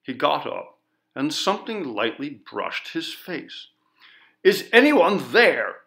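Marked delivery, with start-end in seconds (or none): 4.4-5.8 loud, frightened call into the dark; shouted. A narrator's reading. Man alone in the dark calls out, loud and scared. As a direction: shout to find who's there. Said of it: "this time he knew he had shouted".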